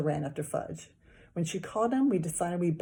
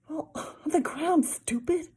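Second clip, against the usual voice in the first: put-on scornful boy; 10 semitones higher, 3 dB louder, slower than usual. A narrator's reading. Boy mocking.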